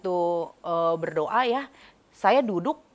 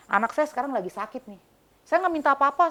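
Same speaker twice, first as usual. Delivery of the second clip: pleading challenge; 8 semitones higher, 5 dB louder than usual. Retold or imitated. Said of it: "kayak sama preman gitu".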